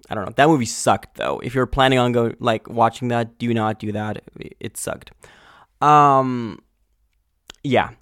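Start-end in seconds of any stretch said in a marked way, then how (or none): none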